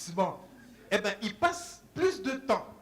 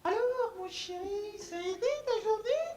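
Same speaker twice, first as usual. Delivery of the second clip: much higher, cooing mother; sweet mother voice; 12 semitones higher than usual. Retold or imitated. Mother home late asking her son about his day. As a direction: coo like a tender mom. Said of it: "Elle arrive, elle lui dit".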